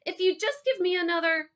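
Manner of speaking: put-on high, loud, desperate boy; urgent kid's plea pitched high; pleading call. A narrator's reading. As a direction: call back begging, then get cut off. Boy pleads while being led out.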